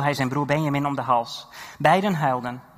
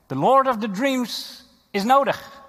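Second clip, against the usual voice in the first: dramatic proclamation; 10 semitones higher, 6 dB louder, slower than usual. Acted. Preacher dramatizes Joseph's call.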